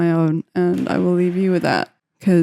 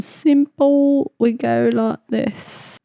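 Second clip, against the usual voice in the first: a hint of a put-on Blake accent, slow; 4 semitones higher, slower than usual. Producer narrating a beat in a joking Blake accent. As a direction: drawl it slowly in a made-up accent. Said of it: "call that the Blake accent".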